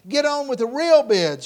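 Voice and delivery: loud, high protest from the crowd; indignant heckle. Acted. Bidder who came for the masters, shouting in protest. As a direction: shout down the auctioneer. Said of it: "Another voice came from the crowd".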